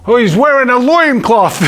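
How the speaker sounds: high, loud put-on Brooklyn accent; mock Brooklyn accent. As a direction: play a New Yorker saying it. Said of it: "it was, like, with an accent".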